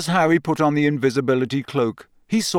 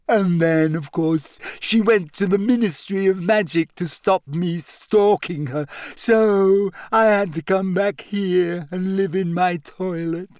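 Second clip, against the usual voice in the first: high, wailing ghost telling her woes; whiny, high-pitched lament; self-pitying ghost girl; 6 semitones higher than usual.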